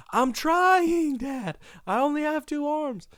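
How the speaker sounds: high-pitched yell back; acted-out exasperated whine-shout; exasperated son. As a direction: holler back in frazzled protest. Son yelling back at dad.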